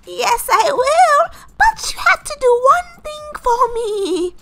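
high, loud, slow godmother voice; odd fairy godmother. Acted. Godmother sets her condition for the wish, high drawn-out voice. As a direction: draw out each word, high and loud. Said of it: "why are you talking like this".

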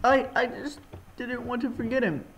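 high, softened, faltering start; upset game character. Acted. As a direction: confess it in a high, small voice.

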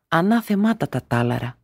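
plain reading voice

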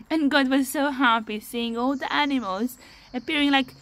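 ordinary talk, nothing marked

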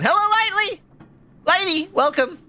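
very high-pitched, squeaky welcome; excited greeting. Real, not acted. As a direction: greet them in a happy squeak. Streamer greets arriving viewer.

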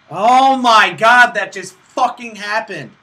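high, loud, very slow exclamation; real disbelief, stretched out; stunned disbelief. Real, not acted. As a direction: drag it out in shock. Shocked by a game event.

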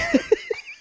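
short high-pitched burst of laughter; laughing. Real, not acted. Cohost cracking up at the acted quarrel, laughing. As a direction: let out one high laugh.

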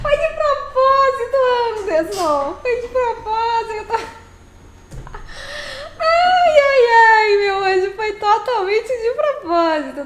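giggling through the words, high and bright with laughter; laughing insistence. Real, not acted. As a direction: insist it was on purpose while cracking up. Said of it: "Cantou errado".

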